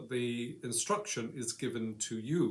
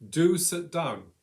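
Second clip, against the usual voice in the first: a firm order leaning on Do; emphatic imperative; 4 semitones higher, 8 dB louder, slower than usual. Shown as a demonstration. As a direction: DO stressed high and loud, then falling. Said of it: "emphasis of the voice is in the first word".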